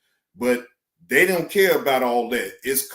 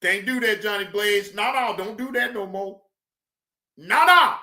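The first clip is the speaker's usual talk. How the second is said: mock scolding; 7 semitones higher than usual. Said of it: "They hit me with a skinny, like".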